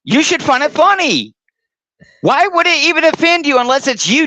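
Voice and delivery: high, sing-song mockery; needling a rival by climbing high; mocking taunt. Mocking taunt repeated higher.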